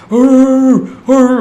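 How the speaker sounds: high loud barking sound; imitated dog bark. Narrator mimicking his dogs barking in the yard.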